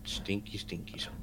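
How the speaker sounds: whispering. Real, not acted. Whispered verdict on a song.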